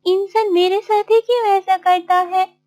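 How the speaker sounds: high-pitched, hurt lament; tearful Martin. Acted. Martin chased away without food, tearful. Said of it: "रोते-रोते".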